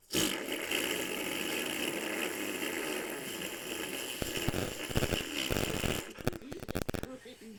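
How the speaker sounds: long, low, breathy grinding noise of a dying machine; imitated machine noise. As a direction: mimic the machine breaking down. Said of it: "the ice cream machine just goes".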